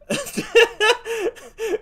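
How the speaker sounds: high-pitched burst of laughter; high giggle. Real, not acted. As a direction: giggle at the grim punchline. Laughs at beaten politician.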